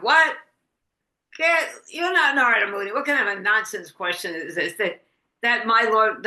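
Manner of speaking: a proud Brahmin snapping at a foolish question; indignant outburst. Acted. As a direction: bristle with offended pride. Brahmin scolds Narada.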